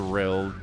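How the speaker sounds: "rill" with a short i, one syllable, slow; Utah rill. Shown as a demonstration. Showing chat Utah vowels, slow "rill" with a short i. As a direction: flatten real into "rill", like fill. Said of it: "Real becomes rill".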